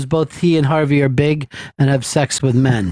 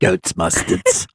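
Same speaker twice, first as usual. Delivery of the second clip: low, slow deadpan catchphrase; understated low imitation; mock put-on voice; 11 semitones lower, slower than usual. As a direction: drop it low and drag it out. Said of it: "This is the new me".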